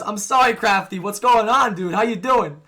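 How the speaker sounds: normal talking voice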